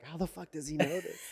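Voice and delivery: imitated dad, laughing through the words; laughing disbelief. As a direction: retell the dad's baffled question while laughing. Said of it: "And his dad is like".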